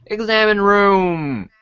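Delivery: usual speaking voice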